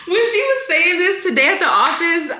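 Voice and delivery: high, laughing voice; amused laughing. Real, not acted. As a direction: talk through real laughter. Amused recalling the child's words.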